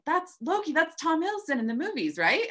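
very high, eager surfer bro; light up like a fan; excited surfer guy. Retold or imitated.

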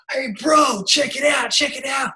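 high-pitched, pumped imitation of an excited pal; imitating an amazed car fan; hyped-up bro. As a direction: call to your mate, mock-thrilled. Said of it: "hyped up conversation".

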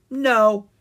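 high, loud contradiction; firm correction. A narrator's reading. Pumpkin King corrects Owen's guess, emphatically. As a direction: cut in to correct him. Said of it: "the Pumpkin King corrected".

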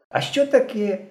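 light, high-pitched unconcern; carefree. A narrator's reading. Henka answering Slavik's reproach, carefree. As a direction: toss it back as if nothing happened. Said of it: "безтурботно відповів Генка".

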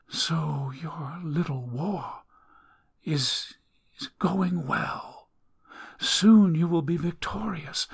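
cryptic deathbed voice; eerie dying woman. Acted. Dying woman speaks of war.

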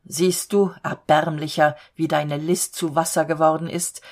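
plain reading voice

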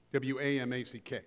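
ordinary delivery

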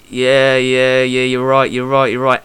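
low, monotone yeah-yeah acceptance; placating agreement. Retold or imitated. Fighter answering his coach's grilling, placating agreement. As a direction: imitate giving in meekly to a telling-off.

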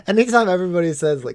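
usual speaking voice

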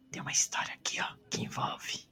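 soft ASMR whisper. Acted. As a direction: lean in and whisper it. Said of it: "Vou falar baixinho aqui pra treinar o S M R".

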